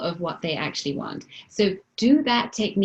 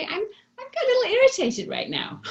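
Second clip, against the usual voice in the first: very high, understated admission; coy, high little confession; toned down; 13 semitones higher than usual. Acted. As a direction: take the drama out, pitched way up. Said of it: "How could you tone it down?".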